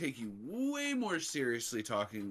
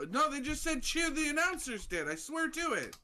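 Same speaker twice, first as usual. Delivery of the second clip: indignant protest; 12 semitones higher than usual. Real, not acted. Arguing over the name's pronunciation.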